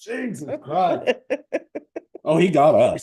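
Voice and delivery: high, loud laughing exclamation. Real, not acted. Laughing after being sprayed.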